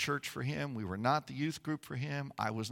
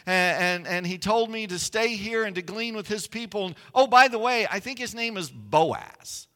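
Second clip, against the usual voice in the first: higher, louder excited telling; excited Ruth; 6 semitones higher, 5 dB louder than usual. Retold or imitated. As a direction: spill the news breathlessly. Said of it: "And she goes".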